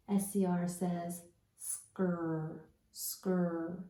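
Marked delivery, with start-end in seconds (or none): 1.6-3.8 "skr" run together as one drawn-out sound; blended cluster sound. Shown as a demonstration. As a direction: sound out "skr" slowly for pupils. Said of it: "say the sound for S C R".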